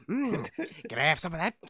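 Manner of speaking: playful imitation of an over-eager creep; Stamper. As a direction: hum greedily, then ask. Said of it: "Yeah, that was like that".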